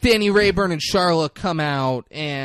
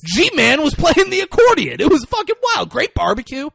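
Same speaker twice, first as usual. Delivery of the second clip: much higher, louder, giddy party rambling; rambling Johnny Trullo; 11 semitones higher, 7 dB louder than usual. Retold or imitated. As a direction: gush about the party like a starstruck fool. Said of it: "I wish you would have been like".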